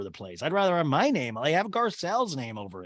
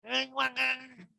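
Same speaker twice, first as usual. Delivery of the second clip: Erica impression; 12 semitones higher than usual. Erica throwing Jade out, high slurred mock shrieking. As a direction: shriek it in caricature, then fade. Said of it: "Erica's like".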